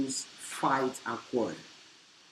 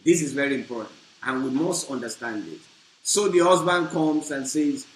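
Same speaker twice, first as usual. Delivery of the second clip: normal talking voice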